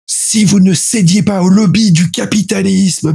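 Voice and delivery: high, slow, grandiloquent tribune voice; mock socialist orator. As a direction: ham up a solemn political speech. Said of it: "Ça, c'est le Parti socialiste".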